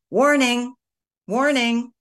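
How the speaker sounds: alarm. Retold or imitated. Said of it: "it's gonna be like".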